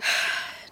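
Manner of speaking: heavy weary sigh; frustrated sigh. Acted. Visitor about to tell her frustrating day, sighing. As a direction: let out an exasperated breath.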